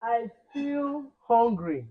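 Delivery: I feel hungry stretched out slowly; slow drawn model line. Shown as a demonstration. Teacher stretching I feel hungry slowly for pupils. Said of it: "teacher modelling hungry slowly".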